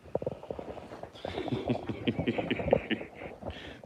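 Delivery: quiet breathy laugh; soft breathy laugh. Real, not acted. Laughing at dog.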